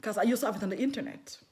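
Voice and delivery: normal talking voice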